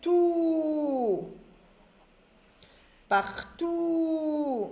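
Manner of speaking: "Tout" and "Partout" with a long, high "ou"; sustained teaching pronunciation of "Tout", "Partout"; elongated vowel. Shown as a demonstration. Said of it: "on allonge un petit peu le son".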